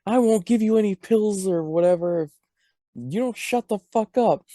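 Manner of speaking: a put-on daughter telling her mom off; mock angry daughter. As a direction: scold your mother, hammed up. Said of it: "And she's like".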